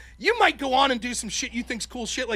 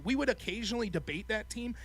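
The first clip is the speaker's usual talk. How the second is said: ordinary delivery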